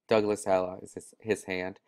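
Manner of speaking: usual speaking voice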